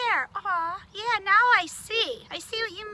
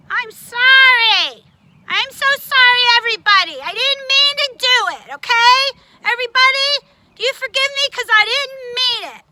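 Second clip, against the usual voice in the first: loud pleading apology; 5 semitones higher, 12 dB louder than usual. Acted. Puppet begging the boys and girls, loud pleading. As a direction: beg the whole class to forgive you. Puppet loudly begs forgiveness.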